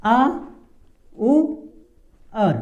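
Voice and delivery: "आ", "उ", "अर" as three isolated sounds with long pauses; broken into sounds. Shown as a demonstration. Teacher builds the word for learners, "आ उ अर" in separated pieces. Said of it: "इसका उच्चारण कैसे करेंगे".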